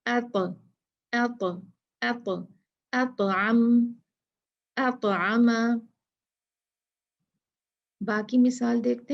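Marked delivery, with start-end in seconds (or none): 0.1-5.6 अत with the ta bounced, then अतअम read slowly and clearly; qalqalah on ta. Shown as a demonstration. Teacher showing students the ta bounce in अतअम. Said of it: "मसला जो है वो ता का है".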